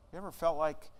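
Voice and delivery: usual speaking voice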